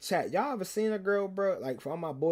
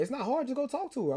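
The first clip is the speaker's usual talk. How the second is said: much higher voice, urging; imitated girl classmate; 9 semitones higher than usual. Classmate urging him to go talk to her, imitated high voice. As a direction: lift the pitch to mimic her nudging.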